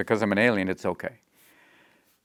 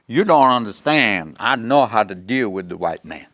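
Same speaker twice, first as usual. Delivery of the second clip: junkie voice; 6 dB louder than usual. Retold or imitated. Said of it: "a junkie going".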